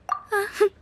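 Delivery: a light, soft amused chuckle; little self-satisfied laugh. Acted. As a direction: chuckle to herself, pleased. Pleased with her own reading.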